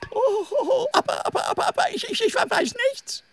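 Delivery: high, panicky stutter; frightened stammer. Acted. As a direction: stammer under threat. Snake threatened by tiger.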